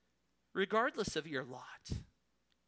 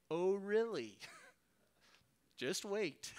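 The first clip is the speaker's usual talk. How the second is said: amused, knowing retort with a laugh; slower than usual. Retold or imitated. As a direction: tease the naive one: just you wait.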